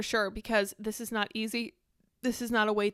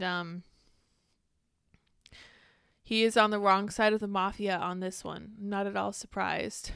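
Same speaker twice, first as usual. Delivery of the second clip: ordinary delivery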